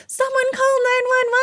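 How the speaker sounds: high, loud mock yell for help; acted-out emergency shout; imitated yell. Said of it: "she yelled".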